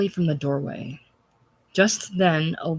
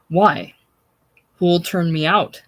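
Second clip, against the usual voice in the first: sneering; 7 dB louder than usual. A narrator's reading. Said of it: "beginning to sneer".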